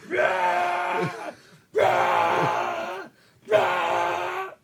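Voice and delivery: very high, loud playful roars; roaring shouts. Acted. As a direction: roar out each one like a beast.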